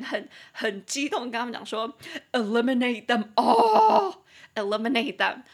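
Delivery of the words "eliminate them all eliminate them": loud excited eliminate them all; excited urging. Retold or imitated. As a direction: egg on the bug killer, loud and eager.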